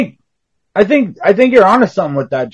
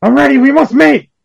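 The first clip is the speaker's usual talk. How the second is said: eager mate voice; 9 semitones higher than usual. Acted. Playing along with the scene.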